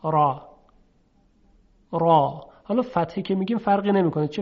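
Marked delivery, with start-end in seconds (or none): 0.0-2.5 thick, heavy ر in «را»; تفخیم. Shown as a demonstration. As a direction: say «را» with a full, dark r. («را» با رای درشت)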